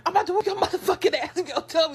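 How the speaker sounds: high, fast mock-angry threat; threatening mom. Mom demanding her kid tell who died, threatening. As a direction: rush it out as a mother at her limit, half laughing.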